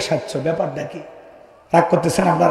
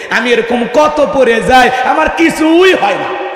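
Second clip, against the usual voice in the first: put-on boy's bravado; 3 semitones higher, 3 dB louder, slower than usual. Happy boy to the preacher after falling, playful bravado. Boy brags that falls don't hurt.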